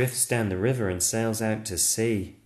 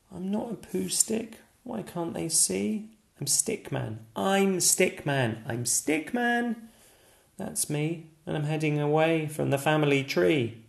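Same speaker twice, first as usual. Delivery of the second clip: higher, earnest little stick figure voice; protesting Stickman; 7 semitones higher than usual. Acted. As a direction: insist on who you are.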